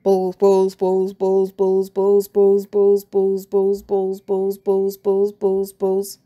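loud obsessive chant in a strange voice; manic repeating. Acted. Teacher muttering to himself while hurrying, loud manic chant. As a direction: gloat over each ball as you chant.

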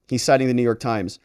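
ordinary delivery

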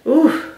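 sudden high ooh of back pain; sharp pained ooh when moving. Real, not acted. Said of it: "I don't know why I hurt my back".

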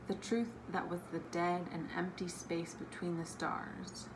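soft, hushed quote reading; quiet reading. Acted. Vlogger reading a book quote to viewers, quiet and hushed.

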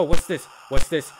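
ordinary delivery

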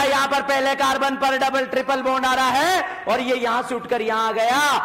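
high, ringing quiz-the-class voice; classroom drill call. Real, not acted. Teacher asking students to count hydrogens, in a high drill call.

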